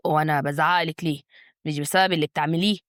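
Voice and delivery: low man's voice, a touch tense; tense husband. A narrator's reading. Husband trying to pull away from his wife, mildly tense. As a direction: answer back low, with slight tension. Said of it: "جواد قال بتوتر".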